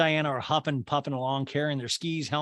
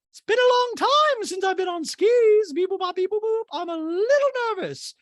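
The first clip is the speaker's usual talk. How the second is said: Dorit impression; 6 dB louder than usual. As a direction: play Dorit jittery. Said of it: "And Dorit goes".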